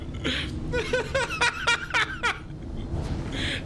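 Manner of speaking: squeaky wheezing laugh; high giggle. Real, not acted. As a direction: let a high laugh roll out. Gamer laughing at flying car.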